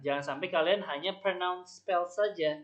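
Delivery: ordinary talk, nothing marked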